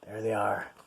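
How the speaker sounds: plain reading voice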